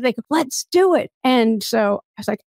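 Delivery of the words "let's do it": imitating their eager, higher-pitched cheer; excited group reply. New acquaintances jumping at the podcaster's challenge idea, excited. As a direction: pitch jumps up in retold excitement. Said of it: "they got all excited".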